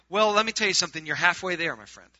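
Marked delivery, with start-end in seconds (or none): none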